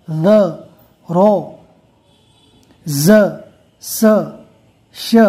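quick, clipped recitation of each letter with ज़बर; teacher recites letter by letter for students to repeat; short letter drill. Shown as a demonstration. Quran teacher reciting letters one by one for students. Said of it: "letter drill".

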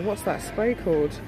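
normal talking voice

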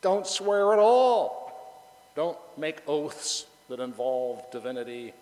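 Jesus's command, drawn out slowly; voicing Jesus. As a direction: proclaim it like a command. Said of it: "He's saying".

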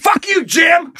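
high comic shouted retort; mock angry yell. Shooter yells back at his neighbour, mock angry yell. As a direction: holler back in hammed-up outrage.

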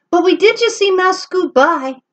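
high, loud bird chorus; chirpy birds. Acted. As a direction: chirp it out together. Birds answer.

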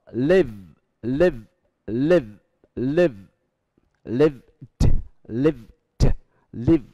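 "lived" ending in a voiced d. Shown as a demonstration. Teacher contrasting "live" and "lived", voiced d.

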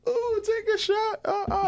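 very high falsetto squeal; high-pitched, teasing party-girl impression; mock giggly partygoers. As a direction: imitate party girls in a slow, high coo. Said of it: "they'll all be kiki kin and".

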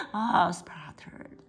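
ordinary delivery, nothing marked

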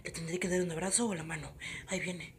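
panicked whispered inner thoughts; nervous whisper. Pretend.

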